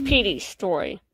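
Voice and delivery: usual speaking voice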